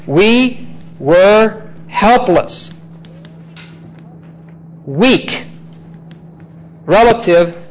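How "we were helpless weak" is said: very slow, every word pressed down; slow with a long pause before weak; emphatic. Shown as a demonstration. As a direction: let helpless and weak land hard. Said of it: "And it's very emphatic".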